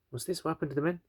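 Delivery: lightly acted bewildered king; baffled king. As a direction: ask it high, mildly taken aback. King baffled at boy's return.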